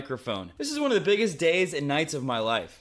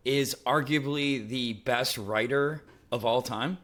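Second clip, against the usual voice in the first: ordinary talk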